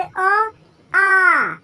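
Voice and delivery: "अ" short and "आ" long, both called out loud; loud clear demo, "अ" short then "आ" stretched long; short and long vowel. Shown as a demonstration. Teacher calling vowels out to the class, loud and long. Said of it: "अ को हम लोग simple तरीके से बोले".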